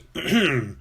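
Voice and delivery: short, polite throat clear. A narrator's reading. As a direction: clear your throat before asking. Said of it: "Pepper cleared her throat".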